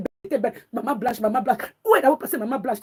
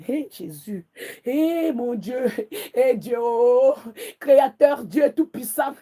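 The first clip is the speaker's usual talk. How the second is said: prière lamentée; much slower than usual. Real, not acted. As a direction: groan it out slowly to heaven. Prays aloud to God. (prière à Dieu, lente et gémissante)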